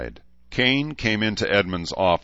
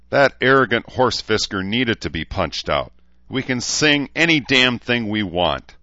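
heated, higher and louder rant; angry; 6 semitones higher, 5 dB louder than usual. A narrator's reading. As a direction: vent indignation with a raised voice. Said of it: "Dean said angrily".

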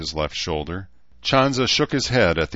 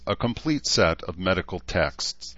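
plain reading voice

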